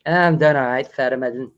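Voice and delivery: bad Aussie accent drifting toward South African; attempted Australian accent. Shown as a demonstration. Host answering his tutor, botched Australian accent. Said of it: "South African".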